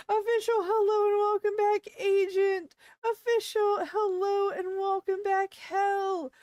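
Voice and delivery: loud, high shout-out to each viewer; hyped welcomes. Real, not acted. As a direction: greet regulars with big energy. Formulaic welcomes to named viewers.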